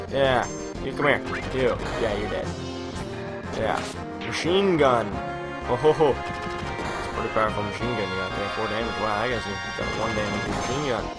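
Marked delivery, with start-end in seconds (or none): none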